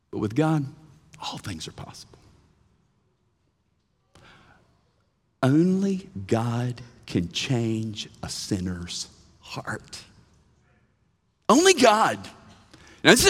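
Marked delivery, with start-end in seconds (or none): 0.1-10.0 soft, slow whisper of awe; hushed whisper. Real, not acted. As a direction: let it fall to a whisper. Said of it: "whispered climax of the point".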